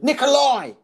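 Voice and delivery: NIKOLAI called out high and loud; mock exasperated shout. Heckler correcting comic, NIKOLAI shouted high. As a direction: imitate a heckler correcting his name.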